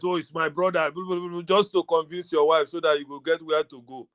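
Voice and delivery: ordinary delivery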